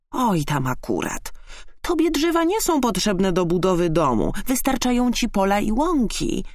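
dismissive huff rising in pitch; scoffing hedgehog. A narrator's reading. Hedgehog dismissing skylark's loss, scornful huff. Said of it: "prychnął Jerzyk".

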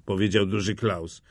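ordinary delivery, nothing marked

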